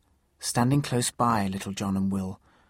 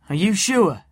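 surprised; 9 semitones higher, 8 dB louder than usual. Acted. Little John questioning Will.

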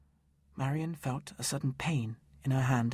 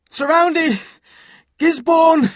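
desperate high shout of alarm; 13 dB louder, slower than usual. Acted. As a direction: yell the warning at full strength. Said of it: "he shouted".

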